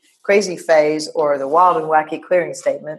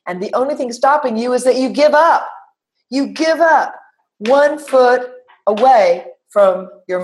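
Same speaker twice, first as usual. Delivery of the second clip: booming motivational speech; 4 semitones higher than usual. Acted. Motivational build to climax.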